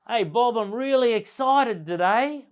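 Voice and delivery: high, loud and bright with excitement; excited. Shown as a demonstration. A sales trainer demoing excited delivery to a room. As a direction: sell the excitement, big and loud. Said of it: "I could say the same words very differently".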